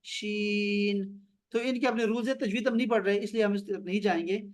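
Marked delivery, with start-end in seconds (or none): 0.0-1.2 "شین" held long and level; elongated letter name. Shown as a demonstration. Teacher reciting Arabic letters, "شین" drawn out long. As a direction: stretch the middle vowel of "شین" steadily. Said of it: "لمبا کرنا ہے".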